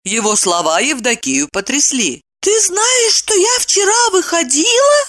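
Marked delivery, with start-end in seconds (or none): 2.4-5.0 stunned wife in a very high voice. A narrator's reading. Wife learns husband knows her outing, stunned and high. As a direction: squeak the question in astonishment. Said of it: "ошеломлённо спросила она".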